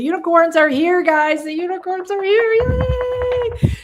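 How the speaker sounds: high, loud, gleeful announcement; excited cheer. Real, not acted. Cheering that the unicorns arrived.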